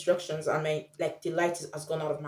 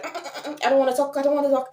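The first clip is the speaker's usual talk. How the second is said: high, loud, panicky "I don't wanna talk"; mock panicked refusal; 8 semitones higher, 6 dB louder, much faster than usual. Stranger hurrying off from a foreign tourist, mock panic. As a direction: play a stranger fleeing in a hammed-up panic. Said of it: "They'll be like ... run away".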